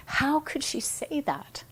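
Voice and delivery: high, whispered, indignant inner voice; whispered indignant thought. Retold or imitated. Scene: her mind judging the client, a high indignant whisper. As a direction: hiss the outraged thought to yourself.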